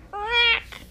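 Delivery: high loud comic quack; mock bird squawk. Reader to children, a high loud squawk.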